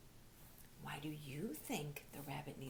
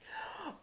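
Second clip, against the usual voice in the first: sharp gasp; 10 semitones higher than usual. Acted. Teddy sees the fox.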